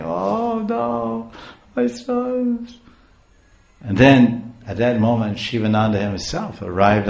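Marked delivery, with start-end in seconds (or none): 0.0-2.8 high, drawn-out wail; mock crying. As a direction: imitate a mother breaking down, pitched high. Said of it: "she immediately broke down crying".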